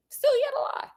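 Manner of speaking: mocking high-pitched repeat aimed at Brian; high sing-song repeat. Shown as a demonstration. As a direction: sing "Still yet a lie" up high, teasing.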